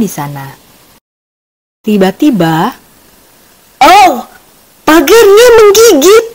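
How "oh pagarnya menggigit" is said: loud, high-pitched yelp of alarm; story character crying out in pain; pained shriek. A narrator's reading. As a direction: shriek as if something just bit you. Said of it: "jerit teman Yaki".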